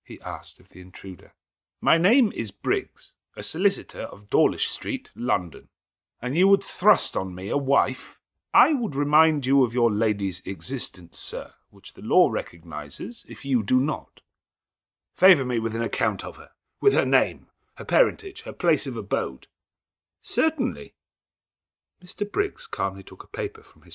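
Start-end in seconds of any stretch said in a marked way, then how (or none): 1.8-19.5 raised, louder character voices; lightly voiced dialogue. Lawyer and groom clash at the altar, raised voices. 20.2-20.9 high, crisp certainly; cool lawyer reply. Acted. Lawyer answers Rochester, high crisp certainty.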